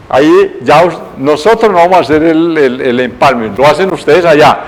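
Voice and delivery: ordinary delivery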